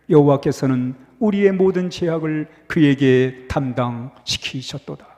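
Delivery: steady, low recitation of the verse; scripture reading. Acted. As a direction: read it out solemnly over the music. Reads scripture aloud over music.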